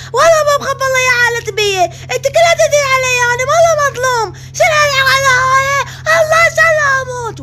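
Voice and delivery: put-on a bratty boy bawling and tattling to mum; crying, shouting son. Son protests being called crazy.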